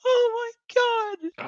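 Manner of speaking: high wheezing laugh; catching breath after laughing hard. Real, not acted. Winding down from a laughing fit.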